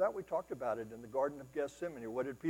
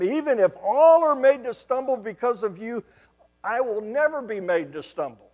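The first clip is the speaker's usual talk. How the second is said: bold boast; 9 semitones higher, 12 dB louder than usual. Acted. Peter boasting loyalty.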